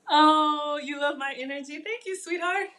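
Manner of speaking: high, sweet gushing thanks; delighted thanks. Real, not acted. Cook thanks a viewer who loves her energy, high and gushing. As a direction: beam back at the compliment.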